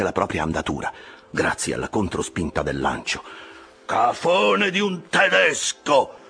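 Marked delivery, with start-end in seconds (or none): 3.9-6.2 high, drawn-out insult; angry yell. A narrator's reading. Officer cursing the rival boat's German, yelling high and slow. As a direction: hurl it slowly at your rival, pitched high. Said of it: "urlò Stubb".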